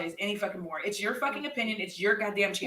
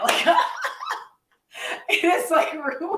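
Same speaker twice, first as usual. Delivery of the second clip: laughing; 9 semitones higher, 4 dB louder, slower than usual. Real, not acted. Laughing about hiccup interruptions.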